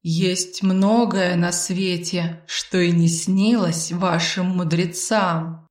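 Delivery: slow, measured pronouncement; lingering, mysterious delivery; Arvil, enigmatic. Acted. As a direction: intone it unhurriedly. Mysterious companion quotes a saying.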